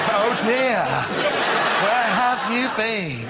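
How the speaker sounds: high, slow, laughing mock-gentle teacher; overly sweet teacher's welcome, half laughing; too-soft teacher. Shown as a demonstration. A teacher to a late pupil, mock-gentle and high. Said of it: "No, it wasn't right".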